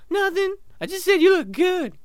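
very high, innocent dolphin cover-up. Acted. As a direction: backpedal in a squeaky dolphin voice. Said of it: "you walk up to the dolphins".

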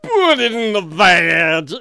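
loud, slow, mushy slur; slurred drunk blurt. Acted. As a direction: bellow it drunkenly, words melting together. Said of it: "But all that comes is".

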